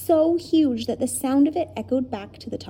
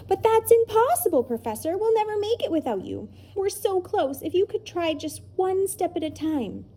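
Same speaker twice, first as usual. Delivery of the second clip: put-on pleading children; 9 semitones higher than usual.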